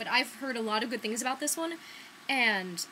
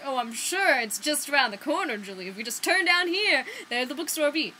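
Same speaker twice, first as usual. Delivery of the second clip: high, loud mock reassurance dripping sarcasm; sarcastic; 4 semitones higher, 7 dB louder than usual. Friend teasing her about the wrong address, sarcastic. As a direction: play the friend mocking her confidence. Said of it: "He's being sarcastic".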